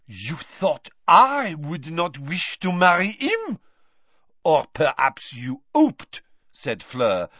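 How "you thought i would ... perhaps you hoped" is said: put-on louder, high French-accented accusation; angry French accent. A narrator's reading. Fleur accusing Mrs Weasley, angry French accent. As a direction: accuse her, nostrils flaring. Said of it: "her nostrils flaring".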